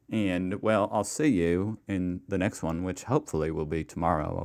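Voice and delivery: cheery outro in a patchy put-on Australian accent; lightly done mixed-up Australian accent; mock Australian accent. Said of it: "do an impression".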